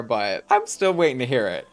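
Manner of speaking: usual speaking voice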